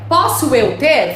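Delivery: slow, deliberate literal gloss; slow word-by-word translation. Shown as a demonstration. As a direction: spell out the word-for-word sense. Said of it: "Se a gente for traduzir ao pé da letra".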